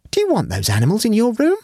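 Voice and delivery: high, incredulous maid's question; voicing an astonished maid. A narrator's reading. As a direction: ask in open amazement, rising high at the end. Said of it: "exclaimed in undisguised astonishment".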